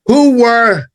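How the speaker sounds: ordinary talk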